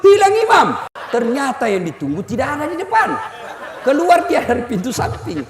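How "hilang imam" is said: high, loud mock alarm; retold yell. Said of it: "Langsung teriak".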